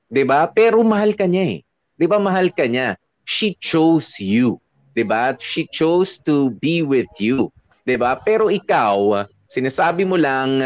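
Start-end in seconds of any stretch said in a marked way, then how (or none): 3.2-4.6 "chose" drawn out and weighted, very slow; slow emphatic stress. Real, not acted. Advice host stressing partner's choice. 5.4-7.5 "chose" stretched, whole line very slow; slow emphatic stress. Real, not acted. Advice host repeating the point.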